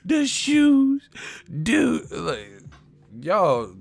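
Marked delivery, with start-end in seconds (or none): 0.0-2.1 high, slow, squealing fan voice; shrieking fake excitement over shoes; mock hyped fan. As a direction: ham up the gushing fan, stretching each word. Mocking hyped fan, shouting.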